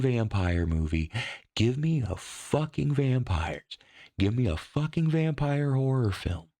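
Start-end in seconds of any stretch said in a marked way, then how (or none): 1.6-6.5 raised voice of a fed-up horror fan; exasperated rant. Real, not acted. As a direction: plead in real frustration for proper vampires. Ranting about a vampire movie.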